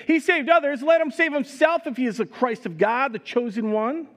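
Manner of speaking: high, loud mocking jeer; sneering. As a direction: jeer it out over the crowd, pitched high. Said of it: "the rulers even sneered at him".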